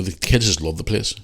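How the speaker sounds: ordinary talk, nothing marked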